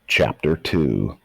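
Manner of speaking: ordinary delivery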